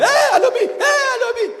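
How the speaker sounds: very high, loud startling shout; sudden high-pitched holler; booming call from God. Acted. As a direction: shout the name as if to terrify the listener. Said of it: "de peur que chaque fois qu'il te parle".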